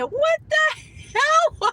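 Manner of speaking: high, slow, drawn-out what the hell; stunned what the hell. Retold or imitated. Retelling the receptionist's crude joke, stretched high and incredulous. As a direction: let the outrage stretch out.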